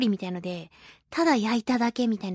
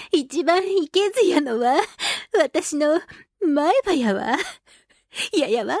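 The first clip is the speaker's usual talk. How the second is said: playful, higher fake Kansai lilt; clumsy Kansai dialect; 4 semitones higher, slower than usual. Host blaming her own front tooth, clumsy Kansai dialect. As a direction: ham up a bad Kansai accent. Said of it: "ヘタピー関西弁楽しくなってしまった".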